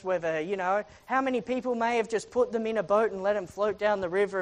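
normal talking voice

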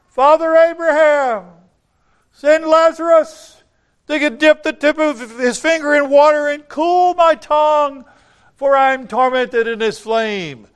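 loud, high-pitched desperate call; anguished plea at full voice, very high; tormented cry. Acted. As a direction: cry out across a great distance in torment. Tormented rich man crying out from hell.

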